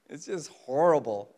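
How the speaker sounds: ordinary delivery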